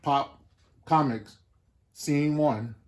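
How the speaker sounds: loud slate call. Real, not acted. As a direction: announce each cue loudly. Calling out film slate.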